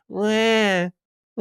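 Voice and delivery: high mock baby wail; imitated child crying. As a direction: fake a whiny "wah" like a crying kid. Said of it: "all you hear while this priest is on".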